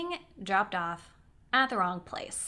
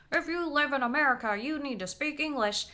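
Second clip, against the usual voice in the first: higher, quick mocking voice; intolerant complainer; 5 semitones higher than usual. Person lecturing drivers about English, mocking imitation. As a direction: imitate a preachy scold. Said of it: "not a person saying".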